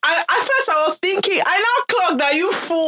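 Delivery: high, giggly telling of the moment; laughing retelling. Real, not acted. Podcast host tells cohost of fan encounter, laughing. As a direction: laugh through the realization. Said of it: "laughing while retelling".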